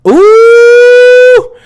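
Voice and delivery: very high, loud held "ooh"; intel noise. Pretend. Streamer sounding the new intel alert, loud high hoot. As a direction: sustain a piercing high siren-like hoot. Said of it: "This is gonna be our intel noise".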